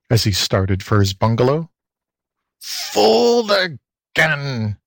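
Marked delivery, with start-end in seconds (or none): 2.6-4.8 high, very drawn-out fox howl. A narrator's reading. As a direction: howl it long in defeat. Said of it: "howled the fox".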